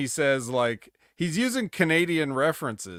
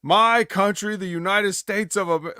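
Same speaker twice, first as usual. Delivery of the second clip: mock heel promo; 6 semitones higher, 5 dB louder than usual. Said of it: "I'm gonna go out here and say".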